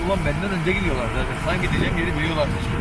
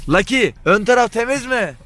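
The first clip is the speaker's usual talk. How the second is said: calling out; 8 semitones higher, 5 dB louder than usual. Real, not acted. Calling to friend from car.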